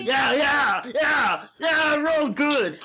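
lightly done Schwarzenegger; Arnold Schwarzenegger impression. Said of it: "That's Arnold Schwarzenegger".

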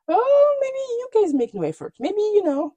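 high-pitched sarcastic "oh"; exaggerated high retort, easing down; mock realization. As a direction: feign sudden discovery to needle them. Sarcastic retort to the complaining couple.

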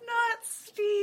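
high, loud squealed protest; mock outrage. Echoing friend's defence of Steve, high squealed protest. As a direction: squeal it like a scandalized fan.

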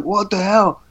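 high-pitched, reenacted disbelief; mock astonishment, pitch jumping up; retold amazement. Retelling first sight of Bruce Lee.

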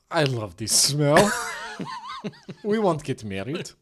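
put-on Ukrainian accent through laughter; Natalie. Bride swooning over a smell, laughing Ukrainian accent. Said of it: "She talks like this".

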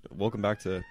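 strained throat after choking; coughing up water. Real, not acted. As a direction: voice still catching from water. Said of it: "water that went down the wrong way".

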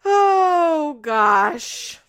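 loud, drawn-out exclamation starting high; amused exasperation. Real, not acted. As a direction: let out a big long groaning exclamation. Exclaims after laughing at story.

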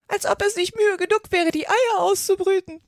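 high, loud bird voice; aggrieved pigeon. A narrator's reading. Pigeon moaning about hatching eggs, aggrieved. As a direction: complain like an overworked mother bird.

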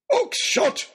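high, forceful bellow. A narrator's reading. Lord Daunt calls after a fleeing figure, bellowed. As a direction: bellow the name across an open square. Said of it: "bellowed Lord Daunt".

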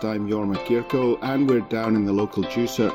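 ordinary delivery

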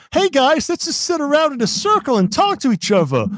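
high, loud, overly cheerful friend; cheery high character voice. Acted. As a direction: chirp it like a naive teen. Trailer character voice.

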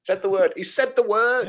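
high, loud teasing cry; teasing excitement. Real, not acted. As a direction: rib him for saying it, gleefully. Teasing the guest over the word.

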